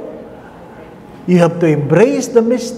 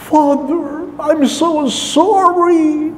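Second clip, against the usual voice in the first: put-on high, slow little old lady apologising; elderly churchgoer voice; 10 semitones higher, much slower than usual. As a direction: play a frail elderly woman saying sorry.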